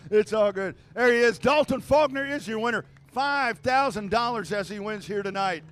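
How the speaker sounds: loud PA announcer voice; race announcer. Acted. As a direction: project it to the whole grandstand. Turns back to crowd on PA.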